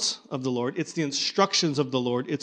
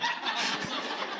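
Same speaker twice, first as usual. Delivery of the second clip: soft laugh; 9 dB quieter than usual. Real, not acted. Laughs at own imitation.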